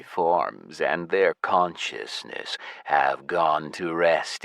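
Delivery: low, slow, flat undead voice; matter-of-fact lich. Acted. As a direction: state it like cold fact, slowly. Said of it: "his tone matter-of-fact".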